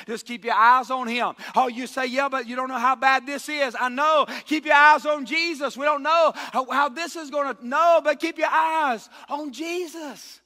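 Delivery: loud, rising preaching; fervent shouted exhortation; full-force preaching. Acted. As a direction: urge the congregation hard, from the pulpit.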